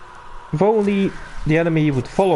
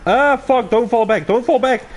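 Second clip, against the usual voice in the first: urgent, raised call to stop; frantic retreat order; 7 semitones higher than usual. Real, not acted. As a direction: shout the correction in a panic. Urgent correction mid firefight.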